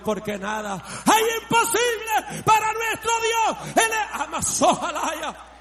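high, loud burst of faith; climactic preaching shout. Real, not acted. Preacher crowning the repeated verse for the church, climactic shout. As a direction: let the verse explode at full voice.

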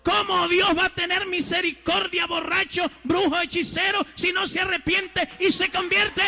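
high, loud shouted preaching; fiery preaching. Acted. Preacher denouncing drunkards and sorcerers, shouting fire and brimstone. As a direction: thunder it at the sinners.

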